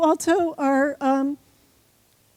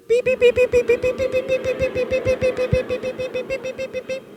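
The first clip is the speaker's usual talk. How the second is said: much higher, rapid robotic beeping; alien beeps; 9 semitones higher than usual. Shown as a demonstration. Speaker trying to get the whole room to be aliens, high beeps. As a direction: chirp like a tiny alien, over and over. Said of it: "we're gonna practice being aliens".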